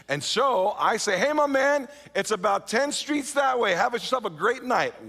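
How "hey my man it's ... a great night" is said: fake casual cheer. Narrator giving a stranger directions while hiding his fear, forced cheerful. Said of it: "don't let on that it's strange".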